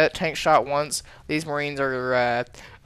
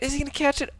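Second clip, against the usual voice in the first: much higher, tense repeat; more excited; 12 semitones higher, faster than usual. Real, not acted. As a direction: push the question up in suspense. Caster watching a chase.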